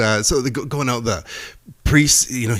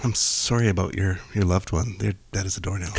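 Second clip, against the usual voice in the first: low, soft pretend sympathy; hushed condolences played for a joke; mock funeral condolence; 4 semitones lower, 5 dB quieter than usual. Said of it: "A funeral".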